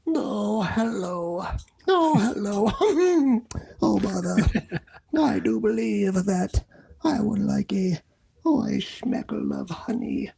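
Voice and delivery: high, slow sleepy bear with a yawn; Pooh Bear voice. Acted. Pooh Bear sighing for honey. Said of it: "they'll mention Pooh Bear".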